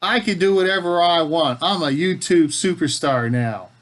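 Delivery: loud, high-pitched playful boast; hammed-up indignant bragging; mock boasting. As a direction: puff yourself up like a big star. Mock boast at daughter's objection.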